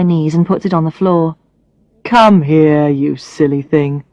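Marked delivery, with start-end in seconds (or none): 2.0-4.0 lightly voiced man, gentle and lower; tender Brad. Brad comforting crying Alex, gentle lowered voice. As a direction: coax her kindly, a touch lower.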